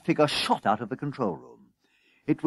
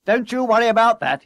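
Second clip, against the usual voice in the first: high, loud brush-off; breezy, overconfident boss; brash dismissal; 10 semitones higher, 8 dB louder than usual. A narrator's reading. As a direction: wave the worry away loudly. Boss loudly dismissing the worry.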